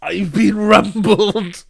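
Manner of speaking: high, loud comic wail; hammed-up cry of being found out; mock outcry. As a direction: draw it out slowly like a culprit caught red-handed.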